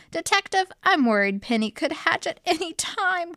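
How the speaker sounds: lightly voiced clucking hen, pitched high; a worried hen, clucking out her words; a hint of a high hen's cluck. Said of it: "clucked Henrietta".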